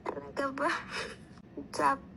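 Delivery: usual speaking voice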